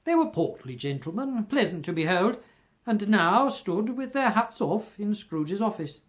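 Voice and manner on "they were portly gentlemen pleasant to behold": usual speaking voice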